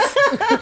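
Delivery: very high burst of laughter. Real, not acted. Laughs at own story.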